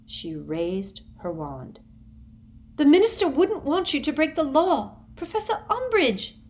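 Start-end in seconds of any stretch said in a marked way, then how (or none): 2.8-6.4 high, loud desperate cry. A narrator's reading. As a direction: plead at the top of her voice. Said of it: "cried Hermione".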